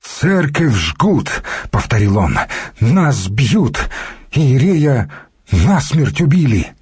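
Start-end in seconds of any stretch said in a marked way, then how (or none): 0.0-1.4 high, very slow lamenting cry; tearful wail. A narrator's reading. Battered monk repeats his news, tearfully wailing. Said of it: "всхлипнул громко". 2.8-6.7 higher, mildly wailing report; tearful lament. A narrator's reading. Battered monk reports the priest killed, tearful lament. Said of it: "всхлипнул громко".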